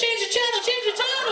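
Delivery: very high, loud frantic demand; hammed-up high-pitched panic; panicked mock shrieking. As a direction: shriek it like a horrified mother. Mother and sister shrieking at him.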